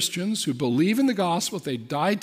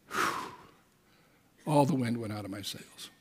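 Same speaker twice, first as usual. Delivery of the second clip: usual speaking voice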